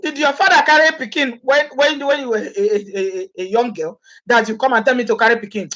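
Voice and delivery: a touch of a proud husband, scoffing; indignant African husband. Retold or imitated. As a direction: play the offended husband refusing to carry the baby. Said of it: "They say".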